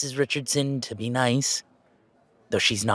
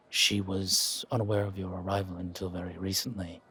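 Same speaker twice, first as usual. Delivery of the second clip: low, soft, distant driver voice; quiet, formal hired driver; reserved chauffeur; 5 semitones lower, 9 dB quieter than usual. Acted. Chauffeur greeting his passenger, low and soft.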